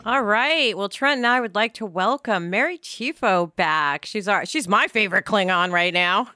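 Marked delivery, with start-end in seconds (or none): none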